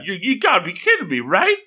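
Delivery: high-pitched mock incredulity; hammed-up shocked girlfriend; shocked disbelief. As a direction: rush in, then climb to a squeal. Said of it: "And this shocks Saki-chan".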